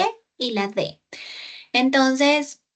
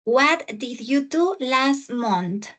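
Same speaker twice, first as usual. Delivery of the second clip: slow model reading; slower than usual. Shown as a demonstration. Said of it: "teacher repeats slowly".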